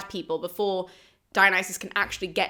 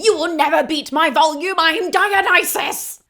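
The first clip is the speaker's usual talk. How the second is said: loud, higher mock shout, slowing on I am Dionysus; boasting yell; 6 semitones higher, 6 dB louder than usual. Dionysus out-yelling the frog chorus, loud mock shout. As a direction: bellow it to drown out the frogs. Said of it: "Dionysus is like".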